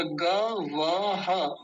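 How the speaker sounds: गवः very slowly: ga, va, ha; syllable-by-syllable demo. Shown as a demonstration. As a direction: separate each sound of गवः, breathing out the final ह. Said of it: "ऐसे". (गवः धीरे, अक्षर-अक्षर)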